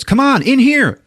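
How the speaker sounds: high, loud beckoning shout; acted urgent call. Cindy calling Rusty into the shed, imitated urgent shout. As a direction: call out as if fleeing danger.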